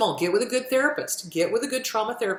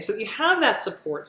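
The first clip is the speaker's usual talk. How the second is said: ordinary delivery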